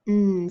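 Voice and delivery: "N" as a long hummed nnn; "N" sustained like a hum; isolated letter sound. Shown as a demonstration. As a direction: hold "N" as a pure sound, not the letter name. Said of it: "Make that N sound".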